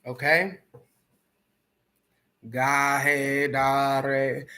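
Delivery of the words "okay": ordinary talk, nothing marked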